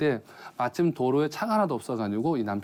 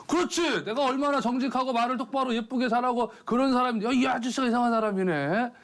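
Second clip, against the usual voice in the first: higher, cocky husband voice; husband emboldened by his wife's praise, higher and cocky; 11 semitones higher than usual. Retold or imitated. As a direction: puff up with borrowed confidence. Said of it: "기가 좀 살아가지고".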